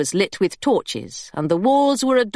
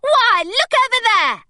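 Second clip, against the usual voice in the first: much higher, theatrical cry of alarm; excited cry; 14 semitones higher, 3 dB louder than usual. A narrator's reading. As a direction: point and call out to distract. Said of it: "she cried".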